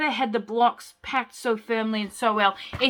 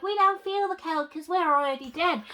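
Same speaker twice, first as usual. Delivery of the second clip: put-on ghost kids; 6 semitones higher than usual. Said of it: "these other kids are like".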